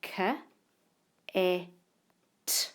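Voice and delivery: each sound said apart: k, e, t. Shown as a demonstration. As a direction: segment the word into its sounds. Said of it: "three sounds".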